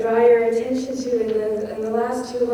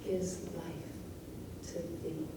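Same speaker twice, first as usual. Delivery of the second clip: slowing to standstill; 6 dB quieter, much slower than usual. Shown as a demonstration. Teacher showing time slowing in the last two lines, hushed and halting. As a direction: let each word halt, sinking into near silence. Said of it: "You're being slowed down to the moment".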